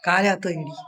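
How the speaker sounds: calling out. Real, not acted. Mother scolds daughter at window.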